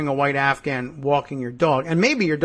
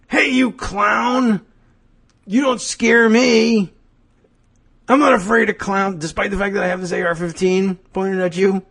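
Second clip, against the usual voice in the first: mock yelling; 4 semitones higher than usual. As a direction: yell a hammed-up challenge, then settle into explaining. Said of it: "and somebody yells at you".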